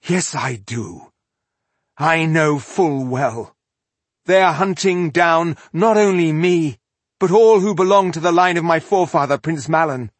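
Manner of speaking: insist, then build to a proclamation; defiant retort, building. A narrator's reading. Rebel woman answering her panicked comrade, defiance building.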